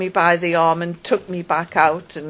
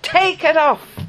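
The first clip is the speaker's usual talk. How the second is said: higher, louder repeated command; insistent soldier; 10 semitones higher, 4 dB louder than usual. Retold or imitated. Female soldier repeats demand to remove skirt, stern high command. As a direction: bark it as a stern guard would.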